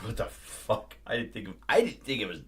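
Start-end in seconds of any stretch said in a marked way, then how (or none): 0.0-1.0 stunned whisper; a whispered aside of shock; whispered disbelief. Retold or imitated. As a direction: breathe it out in disbelief. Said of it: "I'm like".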